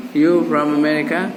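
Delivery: "You from America?" rising like a question; statement words "You from America?" with question intonation; questioning tune. Shown as a demonstration. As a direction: lift the end of "You from America?" to ask it. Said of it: "ucapannya ucapan bertanya".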